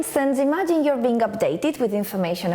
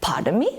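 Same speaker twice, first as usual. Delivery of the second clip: "Pardon me" low, swooping up on "me"; rising intonation; 5 semitones lower than usual. Shown as a demonstration. Teacher demonstrating a polite request for repetition, rising intonation. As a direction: end "Pardon me" as a rising question. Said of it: "our intonation rises".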